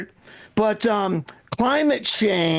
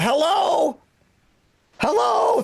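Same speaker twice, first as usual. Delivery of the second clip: high, drawn-out mock call; mother-in-law calling out; 9 semitones higher, slower than usual. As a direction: imitate a confused old lady calling into space. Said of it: "she just randomly calls out".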